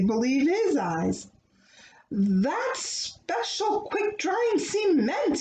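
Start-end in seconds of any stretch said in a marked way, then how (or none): 2.1-5.4 higher, slow mock wail; wailing foreman. A narrator's reading. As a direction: wail it over the ruined cement. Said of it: "he wailed".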